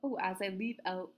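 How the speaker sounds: ordinary talk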